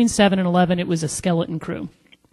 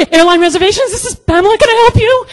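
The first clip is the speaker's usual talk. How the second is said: very high, loud phone greeting; flustered squeal; 12 dB louder, faster than usual. Retold or imitated. Agent answering a call after seeing the twitch, flustered. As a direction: squeak the greeting, barely holding it together.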